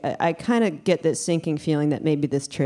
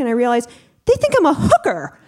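normal talking voice

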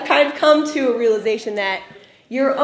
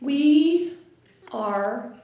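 dawning realization; 6 dB quieter, much slower than usual. Retold or imitated. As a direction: let the awful truth land slowly. Sisters slowly realize the truth.

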